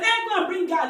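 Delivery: loud retold order; angry command. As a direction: bark it like a furious patriarch. Baba orders woman's death.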